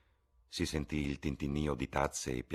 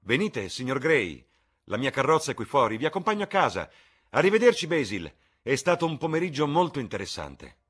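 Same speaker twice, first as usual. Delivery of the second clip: much higher, louder, delighted invitation; exuberant Lord Henry; 10 semitones higher, 10 dB louder than usual. Acted. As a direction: sweep them along gleefully. Lord Henry leaving triumphantly. (Lord Henry esuberante, molto più alto e forte)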